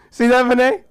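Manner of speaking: high, loud gloating shout; triumphant call-out. Acted. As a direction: crow it at your absent roommate. Calling out triumphantly to roommate.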